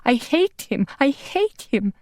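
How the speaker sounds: stomping the dust, sobbing out her hatred; crying out. Acted. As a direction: cry it out in grief and rage.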